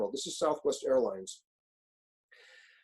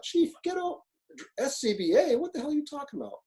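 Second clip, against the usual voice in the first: much higher, mocking voice of a defiant firefighter; defiant driver; 11 semitones higher than usual. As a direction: toss it back at the chief like a cheeky rookie. Driver refusing the chief.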